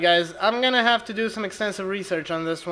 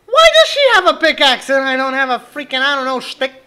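indignant rant; 4 semitones higher, 7 dB louder than usual. Real, not acted. Indignant complaint while fleeing.

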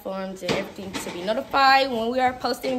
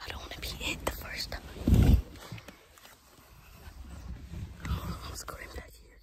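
whispering while hiding; 7 dB quieter than usual. Real, not acted. Hiding in closet during hide and seek.

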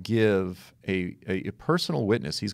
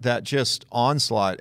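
normal talking voice

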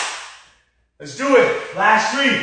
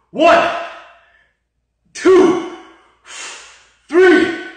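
counting workout reps; 10 semitones higher, 10 dB louder, much slower than usual. Real, not acted. Shouting workout rep count.

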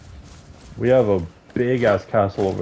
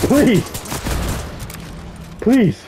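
pleading; 10 semitones higher than usual. Real, not acted. Desperate in the fight.

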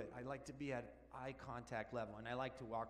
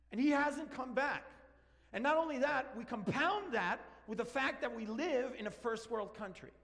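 high, loud, urgent preaching; preaching at full force; 8 semitones higher, 6 dB louder than usual. Real, not acted. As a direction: drive the question home to the congregation. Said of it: "preaching at full force".

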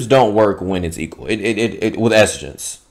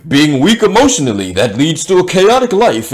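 mock explaining; 7 semitones higher, 5 dB louder than usual. Acting out lecturing her on logic.

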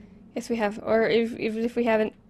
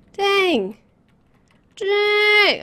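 high, drawn-out Dang; rising playful dang; 9 semitones higher, 6 dB louder than usual. Real, not acted. Player repeats Dang ever higher for fun. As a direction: stretch Dang long and high. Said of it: "Okay, I'll stop".

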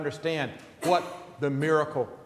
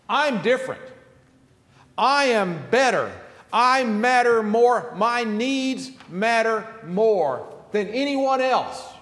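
arrogant boast; 6 semitones higher, 6 dB louder, slower than usual. Acted. Voicing the rich man's arrogant attitude.

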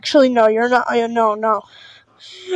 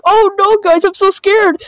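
mock panic; 6 semitones higher than usual. Mock panic over angry commenter.

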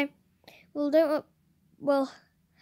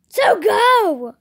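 louder and higher shout; 7 semitones higher, 12 dB louder than usual. Real, not acted. Pushing her friend to go check right now, yelling. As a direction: really yell it, impatient.

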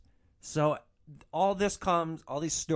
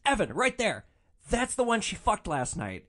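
raised, higher-pitched call; excited pointing out; 7 semitones higher than usual. Retold or imitated. Friend taps driver's shoulder to point out the man, excited. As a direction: urgently point someone out.

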